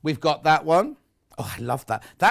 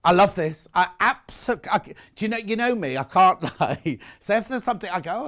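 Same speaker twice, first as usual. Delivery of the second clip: ordinary talk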